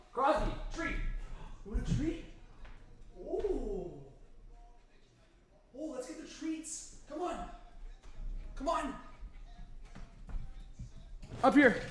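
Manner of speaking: soft, high coaxing to the dog; dog talk. Real, not acted. Streamer coaxing his dog Crosby up with treats, soft high voice. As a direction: coax the dog gently with treats.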